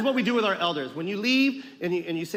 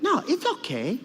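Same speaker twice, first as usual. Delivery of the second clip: put-on high-pitched accented grandmother; Lola Tinay; 7 semitones higher, slower than usual. Grandmother brushes off her grandson's objection, high and insistent. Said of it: "and she'd be like".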